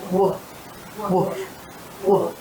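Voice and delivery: "woof" on a soft rounded w; soft w sound. Shown as a demonstration. Teacher showing the wolf sound to a child. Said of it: "What sound does the wolf make".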